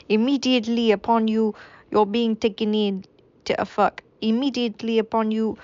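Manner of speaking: a wavering, hammed-up French accent; a French accent slipping away. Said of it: "I don't even think this is French anymore".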